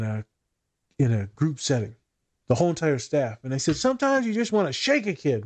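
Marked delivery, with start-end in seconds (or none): 3.8-5.4 high-pitched venting; mock exasperated, voice pitched way up; imitated exasperation. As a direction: vent like a fed-up staffer. Retold exasperated staff venting.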